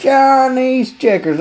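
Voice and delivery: high, slow, laughing through it. Retold or imitated. Customer relishing his find, laughing. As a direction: savor it with a laugh. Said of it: "repeats with a laugh".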